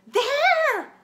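very high, loud scream of there; alarmed scream. A narrator's reading. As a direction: shriek and point. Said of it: "a woman screamed".

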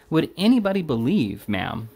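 mildly fierce, high opening dropping on ma'am; a touch of indignant exclaiming; fierce exclamation. A narrator's reading. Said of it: "turning fiercely to the first floor lodger".

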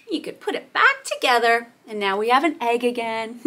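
ordinary talk

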